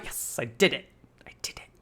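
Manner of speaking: hushed, unvoiced self-congratulation; whispered triumph. Streamer told he said a name right, whispered triumph. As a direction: whisper it, fading to almost nothing.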